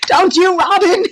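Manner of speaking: loud, high words bursting through laughter; playful, laughing squeal; laughing tease. Real, not acted. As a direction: tease a friend while cracking up. Teasing friend amid big laughter.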